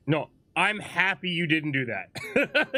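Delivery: usual speaking voice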